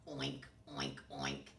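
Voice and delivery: low oinks in a put-on voice; snorting pig. A narrator's reading. Storyteller oinking as the pig for children, low snorted oinks. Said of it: "snorted the pig".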